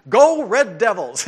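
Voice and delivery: loud, slow pep-rally cheer; mock cheer. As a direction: shout it like a fan in the stands. Cheering the school mascot.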